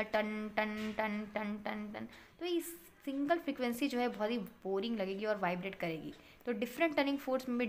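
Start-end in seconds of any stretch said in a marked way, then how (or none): 0.0-2.6 repeated ringing "tan" on one steady note; imitated tuning-fork ring. Shown as a demonstration. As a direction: chime like a struck tuning fork, evenly repeated. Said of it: "इसकी जो frequency होगी single". (Tuning fork जैसी एक सुर में ton-ton)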